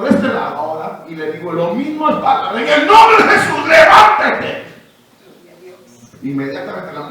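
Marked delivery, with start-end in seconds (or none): 2.6-4.6 grito de mando, mucho más agudo y fuerte; orden gritada. Retold or imitated. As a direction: ordena con toda autoridad que se levante. Commanding the woman to rise. (grito autoritario de sanación)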